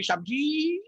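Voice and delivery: a hint of a humble watchman. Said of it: "मस्त चौकीदार का भेष पहन के".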